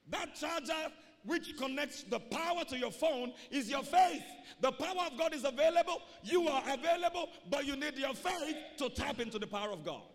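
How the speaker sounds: loud, driving, fast preaching; preaching at full force. Real, not acted. Preacher to congregation, loud driving climax. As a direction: hammer it home to the church.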